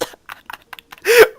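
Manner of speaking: loud, high-pitched laughing; high burst of laughter. Real, not acted. Player cracking up at the name Marcus, laughing.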